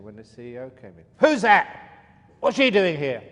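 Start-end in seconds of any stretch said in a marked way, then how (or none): 1.2-3.2 furious shout, much higher and louder; angry boss yelling. Acted. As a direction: bark it at the intruder like a raging CEO. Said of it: "see what it's like to be angry".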